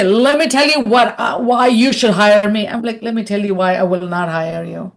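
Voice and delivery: normal talking voice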